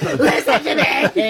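laughing, playfully bossy imitation; imitating Cohen. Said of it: "Cohen's like".

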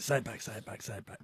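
urgent whisper. Real, not acted. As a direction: hiss it under your breath. Urging quietly off-mic.

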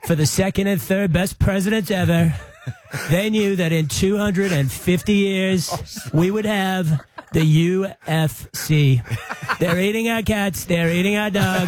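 a slow, grandiose Trump imitation, lightly laughing; Trump impression. Premier does Trump for radio hosts, slow and grandiose. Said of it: "your Trump impersonation".

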